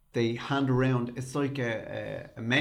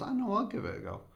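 imitating the mate; 10 semitones higher, 4 dB quieter, faster than usual. Mate volunteers for karaoke.

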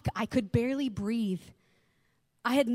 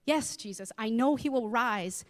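usual speaking voice

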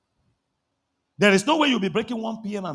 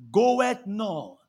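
ordinary talk, nothing marked

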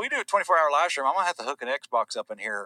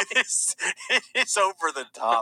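amused, higher voice through laughter; laughing; 6 semitones higher than usual. Real, not acted. Telling co-host how extreme the game is, laughing. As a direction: let a laugh run into the words.

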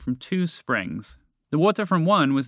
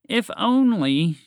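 slow, high, loud sarcasm; drawn-out sarcastic sigh of words; 10 semitones higher, 6 dB louder, much slower than usual. Acted. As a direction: stretch it out with mock wistfulness. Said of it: "she said sarcastically".

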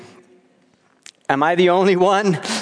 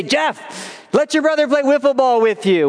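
higher, quick mom voice calling to son; 7 semitones higher than usual. Retold or imitated. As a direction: call out like a mom at the door. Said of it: "she'd say".

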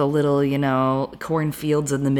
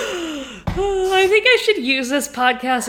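higher, slow words through laughter; laughing; 12 semitones higher, slower than usual. Real, not acted. Cohost laughing about her accent work. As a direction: joke while still laughing.

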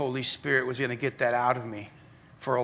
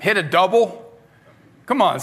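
ordinary talk

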